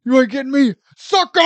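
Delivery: high, loud strained taunt; tough guy taunt. Retold or imitated. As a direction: stretch the sucker until out of breath. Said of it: "All right, Booker T".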